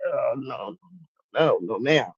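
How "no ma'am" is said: ordinary delivery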